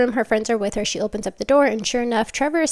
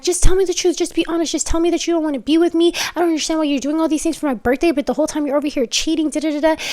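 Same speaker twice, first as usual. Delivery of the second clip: upset accusation; 6 semitones higher, faster than usual. Retold or imitated. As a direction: demand the truth, hurt and fed up. Girlfriend accusing boyfriend of cheating.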